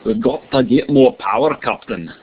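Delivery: put-on playful Scottish accent, like a starship engineer. Teacher jokes to class in Scottish accent. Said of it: "I like doing Scottish accents".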